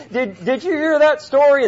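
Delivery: high-pitched, riled-up mock sermon line; mock worked-up preaching. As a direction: imitate yourself getting fired up at the TV. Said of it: "But I start preaching".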